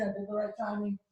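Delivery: ordinary delivery, nothing marked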